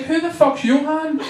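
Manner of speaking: high-pitched mock bewilderment; retold indignant confusion; baffled outburst. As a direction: ask it high, utterly baffled. Retold baffled reaction to wrong name.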